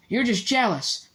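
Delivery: put-on high piping young voice. A narrator's reading. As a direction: pipe up boldly. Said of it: "piped up Colin".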